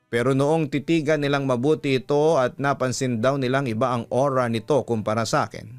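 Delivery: ordinary delivery, nothing marked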